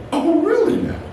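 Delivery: high incredulous laughing question; a laughing high-pitched oh really; surprised. Retold or imitated. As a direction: say it with startled amused disbelief. Mock surprise at biting minks.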